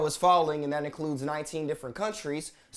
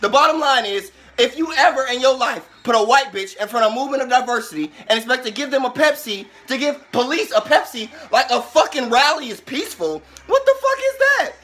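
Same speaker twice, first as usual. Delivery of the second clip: loud, high, shouted tirade; raging shout against the commercial; furious rant; 8 semitones higher, 10 dB louder than usual. Real, not acted. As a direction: rip into Pepsi at full volume. Angry rant at Pepsi ad.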